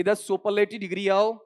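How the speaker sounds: slow dictation. Shown as a demonstration. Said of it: "कोई दिक्कत नहीं".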